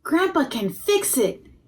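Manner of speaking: put-on a boy sure his grandpa can help; child's voice. A narrator's reading. Joseph pleading for his grandpa, high and eager. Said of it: "Joseph said".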